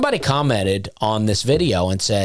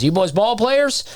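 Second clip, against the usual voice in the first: lightly voiced star, higher pitch; Hackman's question; 12 semitones higher than usual. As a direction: ask the boys in a light mock voice. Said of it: "He goes".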